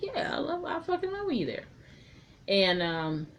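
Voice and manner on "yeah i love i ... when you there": mock high voice. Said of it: "He's like".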